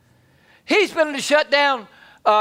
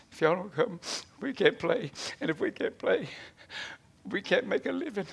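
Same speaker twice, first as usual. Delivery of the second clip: mock whining; 3 semitones lower, 11 dB quieter than usual. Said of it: "he started whining and saying".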